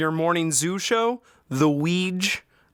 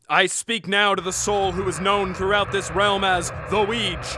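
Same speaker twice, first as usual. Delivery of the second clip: incantation. Acted. Necromancer summoning the dead.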